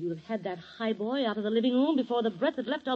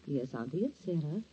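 soft, lower, sweet-voiced coaxing; tender; 3 semitones lower, 5 dB quieter than usual. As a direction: coo sweetly to the dying aunt. Niece soothes dying aunt.

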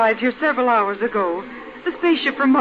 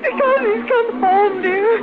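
high, happy-tearful reply; 7 semitones higher than usual. Acted. Mother explaining her tears to son, tearfully happy. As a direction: answer through tears of relief. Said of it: "why are you crying?".

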